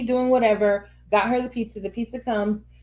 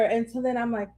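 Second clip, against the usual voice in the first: normal talking voice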